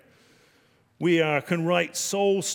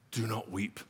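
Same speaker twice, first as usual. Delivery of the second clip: gentle comfort; 5 semitones lower, 9 dB quieter than usual. Retold or imitated. Elder comforting weeping John.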